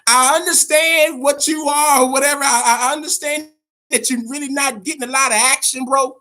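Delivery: high, loud, egging on a gamer; mock pep talk. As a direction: hype him up like a buddy shouting at the screen. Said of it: "I'm like".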